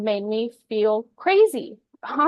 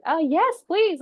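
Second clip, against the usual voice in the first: eager yes; 8 semitones higher, 4 dB louder, slower than usual. Retold or imitated. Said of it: "And I was like".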